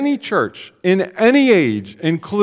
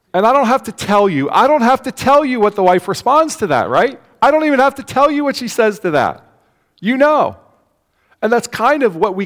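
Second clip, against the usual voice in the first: higher, louder knowing build to the congregation; building emphasis; 7 semitones higher, 5 dB louder than usual. Real, not acted. Preacher teasing the congregation about the wife's reply, higher and louder. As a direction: punch it up with a knowing grin. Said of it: "preacher's rising emphasis".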